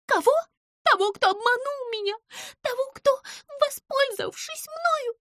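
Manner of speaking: high squeaky outburst, near tears; an angry betrayed mouse close to crying; furious tearful mouse. A narrator's reading. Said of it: "глаза яростно сверкнули".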